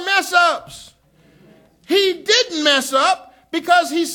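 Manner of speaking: loud, high, slow shouted preaching; full-force preaching. Real, not acted. Pastor shouts to the congregation, full-force preaching. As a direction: shout the grace of God over their mess-ups.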